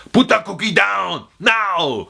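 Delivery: yelled Schwarzenegger catchphrase; Arnold Schwarzenegger. Retold or imitated. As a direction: bark the order like the action star. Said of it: "Arnold Schwarzenegger".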